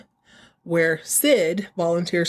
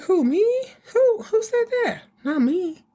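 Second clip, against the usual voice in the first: much higher, squeaky mock innocence with a laugh; mock innocent squeal; 14 semitones higher than usual. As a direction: play the caught liar going high and innocent.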